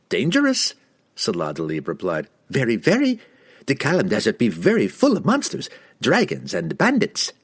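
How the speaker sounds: high, warning merchant voice; wheedling, nervous trader. A narrator's reading.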